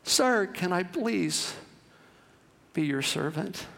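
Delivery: starts high, then slow and fading to soft; little girl pleading with the buyer. A narrator's reading. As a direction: beg him from breathless to quiet. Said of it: "running after him going".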